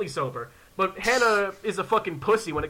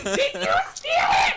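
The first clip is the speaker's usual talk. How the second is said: very high, drawn-out mock shriek; little-girl shriek; slower than usual. Cornfield actress running back at visitors, little-girl shriek. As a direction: screech it as the crazed child. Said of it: "pretending to be, like, a little girl".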